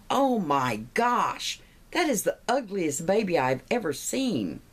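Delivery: high, drawn-out appalled reaction; hammed-up shock; mock horror. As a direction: act aghast, stretching the exclamation. Bus driver appalled by baby.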